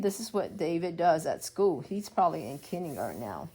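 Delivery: ordinary delivery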